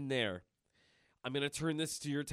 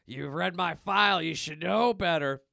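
Logan growling; 4 semitones higher, 6 dB louder than usual. Retold or imitated. Logan threatening the hidden boss, mock tough louder voice. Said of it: "he's like".

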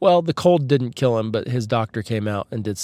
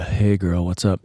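sexy voice; 5 semitones lower than usual. Shown as a demonstration. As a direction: lower pitch, a playful seductive pickup line. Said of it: "if you're hearing a little bit of".

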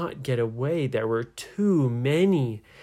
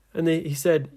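plain reading voice

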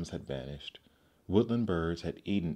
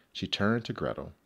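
usual speaking voice

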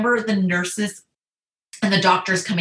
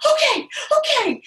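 high-pitched, squeaky mock compliance; imitated breathless little yes; flustered agreement. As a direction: re-enact nervous readiness way up high. Retold frightened agreement to doctor.

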